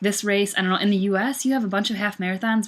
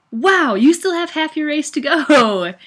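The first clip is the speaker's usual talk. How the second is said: high, loud, drawn-out mock dismay; hammed-up wail of disbelief, slow and high; worst feeling; 9 semitones higher, 8 dB louder, slower than usual. Runner facing the second half of the race, mock dismay. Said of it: "worst feeling and best feeling".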